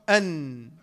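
"أن" with the ن humming through the nose; nasal noon. Shown as a demonstration. Teacher showing where the air exits, nasal hum on "أن". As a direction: hold the ن of "أن" in the nose. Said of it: "من الخيشوم".

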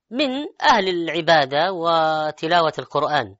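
ordinary delivery

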